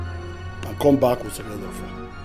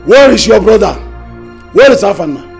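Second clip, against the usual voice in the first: much louder, pressing question; elder shouting an interrogation; loud demand; 4 semitones higher, 10 dB louder, faster than usual. Acted. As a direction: demand an answer, loud and hard. Elder demanding the missing prince.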